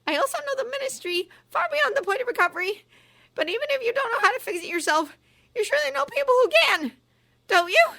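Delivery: high, loud shrill pressing; rising to a shriek. A narrator's reading. Umbridge pressing Ron for help, rising to a loud shriek. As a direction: climb to a shriek as you push him. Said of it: "her voice rising to a shriek".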